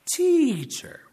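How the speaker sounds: Sadducees bait Jesus, repeating a high mock "teacher,". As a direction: stretch out an oily "teacher," to bait him. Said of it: "They say".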